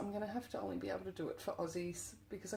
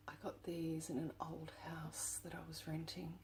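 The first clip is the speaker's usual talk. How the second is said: much softer, hushed confiding whisper; 9 dB quieter than usual. Real, not acted. Whispering to viewers about the keys from her old rental. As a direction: lean in and whisper it.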